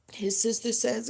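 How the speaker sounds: usual speaking voice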